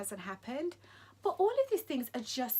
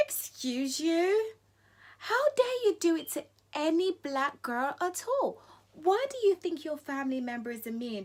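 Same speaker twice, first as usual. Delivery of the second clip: indignant retort; 5 semitones higher, 5 dB louder than usual. Retold or imitated. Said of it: "I'm like".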